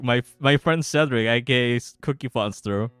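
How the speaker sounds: normal talking voice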